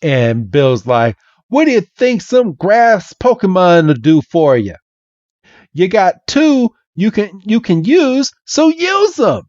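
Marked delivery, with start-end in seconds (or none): 1.5-9.4 high, exasperated Southern scolding; hammed-up Southern accent, rising to the end; Bill. Bill telling Red which Pokemon to use, Southern accent. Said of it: "this Southern accent".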